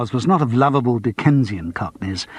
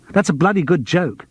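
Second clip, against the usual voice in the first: a sharp, offended snap; snapped; 5 semitones higher than usual. A narrator's reading. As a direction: bite the line back at him. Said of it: "snapped Paul Royce".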